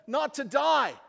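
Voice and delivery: high, loud shout on not to die; preached shout. Acted. Preacher shouting that God made people to live. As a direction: cry it out to the back pews. Sermon climax.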